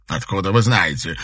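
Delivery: astonished. A narrator's reading. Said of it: "изумился Сикорский".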